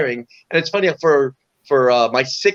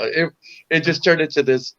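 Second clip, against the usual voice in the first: normal talking voice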